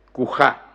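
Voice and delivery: "Cujá" with a clear aspirated j, final vowel stressed; aspirated dialect form. Shown as a demonstration. Lecturer demonstrating the Málaga dialect form to the audience, aspirated. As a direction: breathe the j in "Cujá" and stop on the á. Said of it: "Mediante nítida aspiración".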